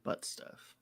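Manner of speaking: breathy whisper, barely audible; whispered joke. Real, not acted. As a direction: whisper it as a cheeky aside. Sneaky joke aside.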